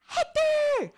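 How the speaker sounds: high, drawn-out whoop of a name; calling out a greeting. Real, not acted. Host hails a guest who just joined, high excited call.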